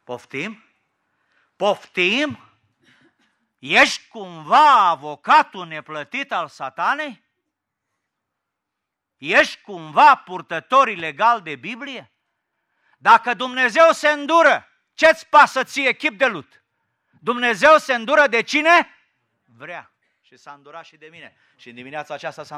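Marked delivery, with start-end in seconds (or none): 1.6-2.5 louder, higher, drawn-out question; indignant disbelief. Acted. Asks again, growing more indignant. 3.6-18.9 loud, confrontational pulpit challenge; raised preacher's voice, building higher; forceful preaching. Real, not acted.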